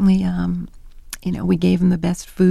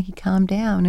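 usual speaking voice

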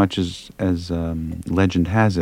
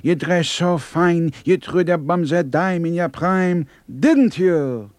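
put-on high, slow old Yiddish Dylan; old [name] Yiddish accent; 7 semitones higher, slower than usual. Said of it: "the eighty-six-year-old [name]".

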